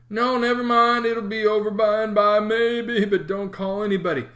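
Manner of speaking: put-on groaning sick boy pleading; a feeble boy putting on pain. A narrator's reading. Tom acting sick, pleading.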